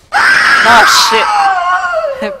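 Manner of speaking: high, loud, drawn-out startled cry; startled shout. Real, not acted. Streamer jumps at a scare in the game, startled shout. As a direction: yelp it in real fright.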